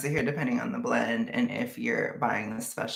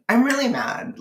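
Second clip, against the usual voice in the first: high, louder burst of annoyance; mad; 12 semitones higher, 5 dB louder than usual. Real, not acted. Vlogger venting about having to change, mad. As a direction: push it up high, really mad. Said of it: "I'm just really mad".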